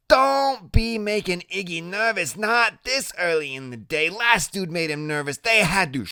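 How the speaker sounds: high-pitched woman's nagging warning; biker's woman warning off a kid, high; warning Loretta. A narrator's reading. Loretta warning the kid about Iggy's nerves, high and nagging.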